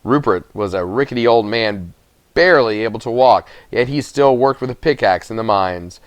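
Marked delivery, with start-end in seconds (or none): none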